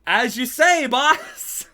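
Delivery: high, loud yes-boss reply; hammed-up "yes boss"; mock obedient. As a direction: play the eager lackey. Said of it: "Jungle Boy's like".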